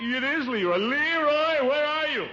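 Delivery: high, excited holler; calling out. Acted. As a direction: shout up the cliff to your nephew. Calls up to nephew above.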